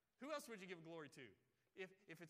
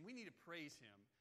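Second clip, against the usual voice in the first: ordinary talk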